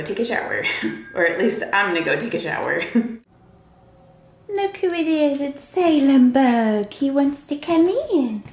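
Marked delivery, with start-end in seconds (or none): 4.5-8.4 high, slow, sing-song voice for the cat. Real, not acted. Greeting her cat Salem at the door, sweet baby talk.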